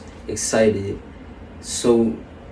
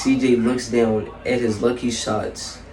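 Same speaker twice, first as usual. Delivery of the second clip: ordinary delivery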